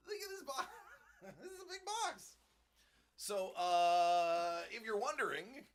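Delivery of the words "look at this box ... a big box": very high, soft squeal through laughter; tiny high voice breaking into giggles; laughing amazement. Real, not acted. Amazed at huge box, laughing.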